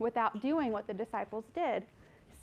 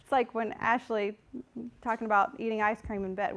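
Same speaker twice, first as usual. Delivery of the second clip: normal talking voice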